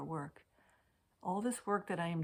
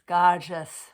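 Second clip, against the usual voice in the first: "gorgeous" belted out much louder; loud gushing; 13 dB louder than usual. Shown as a demonstration. Tarot reader gushing over the card, shouting "gorgeous". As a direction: blast the word "gorgeous" with delight. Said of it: "and gorgeous".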